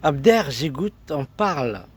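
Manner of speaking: normal talking voice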